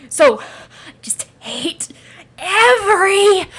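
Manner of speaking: halting build to a loud, high wail; hateful outburst. Acted. Creature hating everything about itself, wailing in rage. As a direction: stretch the last word into a drawn-out yell. Creature's self-loathing peaks.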